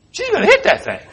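high giggly certainty, through a laugh; laughing prediction. Retold or imitated. Preacher retelling the airport collision, laughing high. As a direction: laugh it out, she's doomed. Watching woman about to collide.

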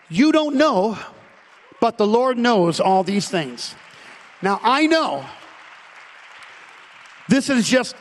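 loud, high, slowed preaching cry; full-force preaching. Acted. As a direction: drive the point home to the congregation.

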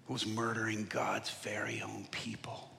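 whispered aside; soft whispered line; hushed. Acted. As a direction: drop to a whisper. Hushed dramatic sermon moment.

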